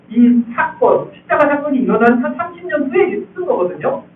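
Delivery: ordinary talk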